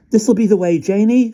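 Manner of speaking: put-on high, loud boyish call; calling to Janie across the way. A narrator's reading. Max calling to Janie, loud and high. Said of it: "called Max".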